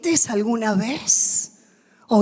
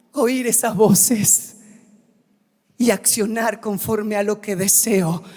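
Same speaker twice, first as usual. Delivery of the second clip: usual speaking voice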